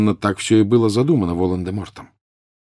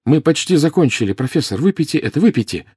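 high, insistent pleading; tense, raised-pitch urging; urgent coaxing; 7 semitones higher, 3 dB louder than usual. A narrator's reading. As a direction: hurry him on anxiously. Harry urges professor to drink on.

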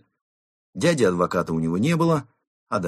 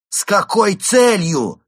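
high, loud, drawn-out cry; exclaimed demand; 13 semitones higher, 11 dB louder, slower than usual. A narrator's reading. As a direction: shout the question in exasperation. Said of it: "вскричал инспектор". (громкий возмущённый вскрик)

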